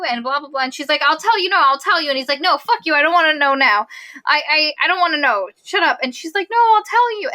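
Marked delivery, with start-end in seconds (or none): 1.0-2.1 high, louder mock pleading; pitched-up, pushy quote; retold insistence. 2.4-6.0 retold angry refusal. Retold quarrel, refusing to listen. 6.5-7.3 very high, louder mock pleading; high-pitched insistent quote; retold insistence.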